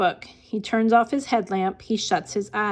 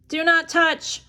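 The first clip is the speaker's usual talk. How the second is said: raised, sharp warning; yell; 6 semitones higher than usual. A narrator's reading. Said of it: "Frank yells".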